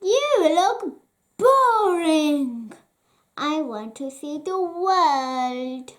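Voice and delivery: a bored puppet crying out; a hint of a bratty wooden boy; whiny puppet cry. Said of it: "Pinocchio cried".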